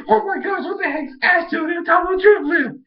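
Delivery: very high mock panic; imitating a frantic freak-out. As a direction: squeal it like an alarmed little creature. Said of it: "he's, like, freaking out".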